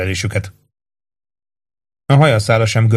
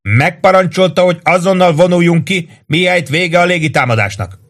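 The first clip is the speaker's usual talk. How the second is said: shouting up; 8 semitones higher than usual. A narrator's reading. Lieutenant shouting up to the roof during an air raid. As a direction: call it up to the roof. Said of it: "kiabált föl Ross főhadnagy".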